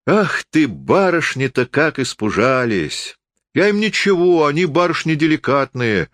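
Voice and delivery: high, sing-song mock pity; drunken forester, teasing. A narrator's reading. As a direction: tease them as frightened young ladies. (лесник насмешливо жалеет барышень)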